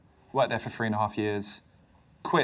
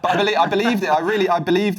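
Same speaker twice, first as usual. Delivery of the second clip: laughing; 9 semitones higher, 9 dB louder, faster than usual. Real, not acted. Laughing after apologising to Mum.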